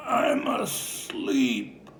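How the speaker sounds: very slow, groggy mumble; drowsy sleeper. Retold or imitated. The woken moviegoer telling the rescuer he was sleeping, slow groggy mumble. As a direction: drag it out like you're half asleep.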